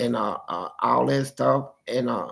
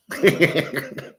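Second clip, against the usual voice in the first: hearty laugh; 2 semitones higher than usual. Real, not acted. Laughing at own story.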